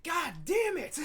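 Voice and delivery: high, loud mock outburst of frustration; retold frustrated yell. Player retelling his frustration at the game, high mock yell. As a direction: blurt it like you just got poisoned again. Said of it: "I'm like".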